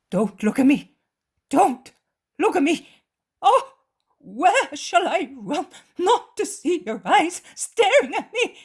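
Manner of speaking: shrieking high and loud in terror; desperate panicked screaming; terrified shout. A narrator's reading. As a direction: scream it out as if haunted by the victim's eyes. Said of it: "shouts the man".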